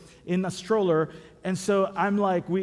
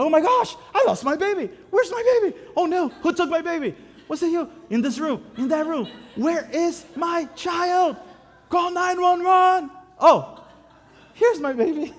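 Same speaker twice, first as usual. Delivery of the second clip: high, frantic mock-panicked mother; panicked mom; 9 semitones higher, 4 dB louder than usual. Pastor voices the mom in the video, high mock panic. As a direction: squeal like a mom who lost her baby. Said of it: "And she's like".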